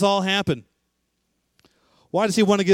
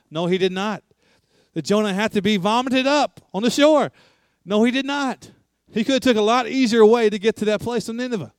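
rising preaching; 4 semitones higher than usual. Acted. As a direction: drive the point home, building with every no. Preaching builds to full force.

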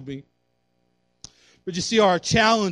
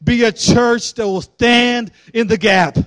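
loud, raised-pitch preaching at full force; prophetic declaration; 6 semitones higher, 9 dB louder than usual. Real, not acted. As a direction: proclaim it over the church with drawn-out emphasis. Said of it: "I just want to prophesy right now".

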